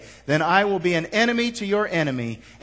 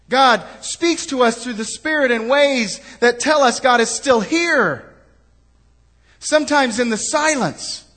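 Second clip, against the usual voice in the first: higher, louder preaching, driving every point; preaching at full force; 7 semitones higher, 4 dB louder than usual. Acted. Pastor pressing his congregation to act, full-force preaching. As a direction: urge the church to hear and do.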